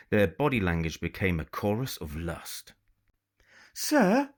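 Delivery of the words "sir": high, bright call across to the captain; young lady calling out high to the pirate captain. A narrator's reading. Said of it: "Lucy called out to the pirate captain".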